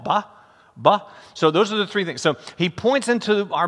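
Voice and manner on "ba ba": "Ba" said for ball, the last sound dropped; baby-talk "Ba" meaning ball; toddler's ball. Retold or imitated. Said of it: "He can say ball".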